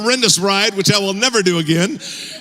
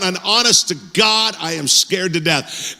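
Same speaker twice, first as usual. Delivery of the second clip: ordinary talk, nothing marked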